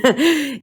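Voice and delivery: high cackling laugh; a wicked little laugh; witch cackle. Acted. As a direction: witch laughing at her own joke. Witch laughs at her joke.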